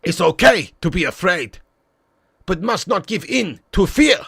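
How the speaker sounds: lightly put-on wise mentor voice; Miyagi. Streamer quoting Karate Kid wisdom to a viewer, lightly put-on mentor. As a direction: deliver a sage maxim with a touch of character. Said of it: "Dutch Miyagi knows".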